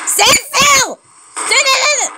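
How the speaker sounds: high, strained frustrated order; frantic command. Real, not acted. Player ordering a dodging target, frantic. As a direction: bark it high and desperate.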